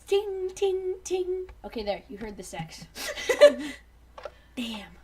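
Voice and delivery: ordinary talk, nothing marked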